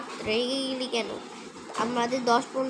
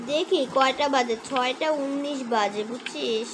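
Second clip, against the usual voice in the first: ordinary delivery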